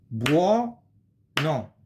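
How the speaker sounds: "Buono" stretched slowly syllable by syllable, bwo-no; teacher demonstrates the word's syllables; drawn-out Italian. Shown as a demonstration. Teacher stretching buono so students hear its syllables.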